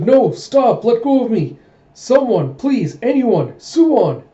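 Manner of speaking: high, loud acted pleading; frantic, hammed-up yell; panicked cry for help. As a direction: shout desperately for anyone to rescue you. Grabbed, cries out for help.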